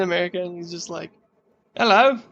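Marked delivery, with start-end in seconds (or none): 1.8-2.2 a quick Australian "Hello." said a bit higher and louder; Australian accent. Retold or imitated. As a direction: throw out "Hello." like an Aussie. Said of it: "That was Australian as fuck".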